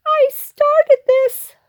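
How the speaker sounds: slow, very high little moan; squeaky, drawn-out guilty moan. Acted. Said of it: "moaned Pip Squeak".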